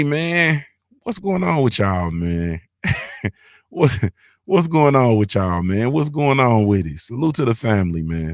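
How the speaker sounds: normal talking voice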